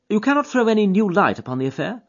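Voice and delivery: keen detective to his friend; Holmes voice. Acted. Holmes to Watson on the train, eager and keen.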